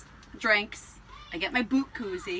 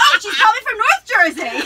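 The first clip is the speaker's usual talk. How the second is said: high, loud laughing jab; squealing with laughter; laughing tease; 10 semitones higher, 11 dB louder than usual. Retold or imitated. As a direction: laugh it out as a cheeky comeback. Retold teasing reply, laughing.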